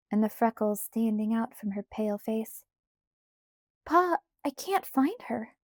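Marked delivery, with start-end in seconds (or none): 3.8-5.5 put-on high, worried young girl; scared child. A narrator's reading. Scared Carrie tells Pa she cannot find Grace, alarmed. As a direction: report it with rising alarm. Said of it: "her eyes large and scared".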